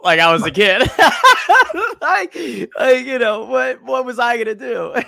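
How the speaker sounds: high, loud protest through real laughter; laughing self-defence. Real, not acted. Young commentator excusing himself to host, laughing protest. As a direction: plead your case while cracking up.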